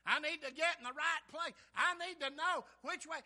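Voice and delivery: high, loud pleading; urgent prayer. Acted. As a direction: plead with God for direction. Acts out prayer for direction.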